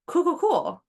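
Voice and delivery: brightly brushing it off; mock chirp. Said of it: "it's like".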